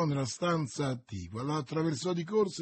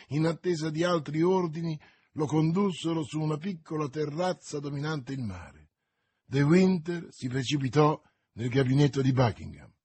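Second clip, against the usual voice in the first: ordinary delivery, nothing marked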